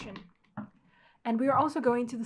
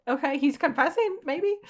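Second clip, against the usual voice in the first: higher and louder, rising to a squeal on maybe; excited reaction; 5 semitones higher, 10 dB louder than usual. Real, not acted. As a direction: get giddy at the confession. Reacting to confession on screen.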